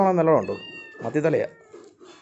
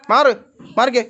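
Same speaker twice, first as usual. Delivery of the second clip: higher, loud move-away orders; shooing loudly; 7 semitones higher, 11 dB louder than usual. Real, not acted. Owner shooing cats off the fish, loud. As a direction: bark them back sharply. Pushing animals away again.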